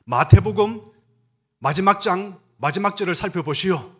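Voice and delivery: missionary Livingstone answering the reporters, proud and loud; Livingstone's answer. Retold or imitated. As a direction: proclaim it like a triumphant missionary. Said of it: "이렇게 대답합니다".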